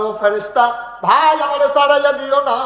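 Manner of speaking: high, loud wailing plea; tearful plea. Acted. Dead mother begging the angel not to chase her away, wailing plea. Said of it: "তোমার মা কান্দে আর কয়".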